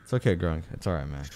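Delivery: calm a frightened friend; reassuring. Real, not acted. Player gently reassures scared teammate Grunk, soft and low.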